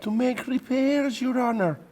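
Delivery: very high, frightened pleading; pleading pilot. Acted. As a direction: beg for your neck in a thin, high voice. Pilot pleads for his life.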